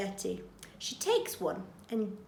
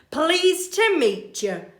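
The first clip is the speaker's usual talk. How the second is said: gallant burglar; 7 semitones higher, 8 dB louder, slower than usual. Acted. Bill greets Betty.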